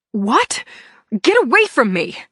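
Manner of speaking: loud panicked shout; frightened yell. A narrator's reading. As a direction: yell to push them off. Attacked, fights back.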